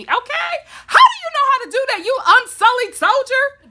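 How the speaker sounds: high, loud, fast scandalized shout; shocked shout. Real, not acted. Fan shouting at Grey Worm after the scene, high and loud. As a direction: shriek it in disbelief.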